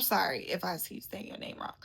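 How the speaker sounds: soft unvoiced whisper, sheepish; whispered apology. Real, not acted. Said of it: "whispered apology".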